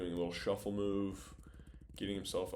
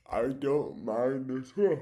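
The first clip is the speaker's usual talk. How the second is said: slow words stretched through a yawn; 6 semitones higher, 9 dB louder, slower than usual. Real, not acted. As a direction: talk right through a big yawn. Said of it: "I always yawn in".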